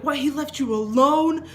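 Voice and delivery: put-on as if you can't believe he left your kid alone; shocked mom. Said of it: "And she's like".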